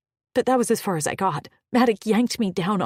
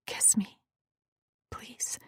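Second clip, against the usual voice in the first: whispered plea; 2 semitones higher, 8 dB quieter, slower than usual. Acted. Intimate plea after whispering.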